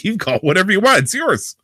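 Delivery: high, animated dismissive retort; hammed-up "whatever you want" voice; mock quote. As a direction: toss it off high and incredulous, as an acted quote. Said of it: "I'm gonna say it's like".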